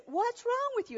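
high-pitched mock yell without real volume; retold yell. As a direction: pitch it up as if to someone deaf. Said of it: "he yells it really loud".

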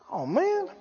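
high, hushed mock dismay; worked up. Preacher voices someone worked up about others, in hushed mock dismay. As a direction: act out a soft, high-pitched realization. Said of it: "getting all worked up about them".

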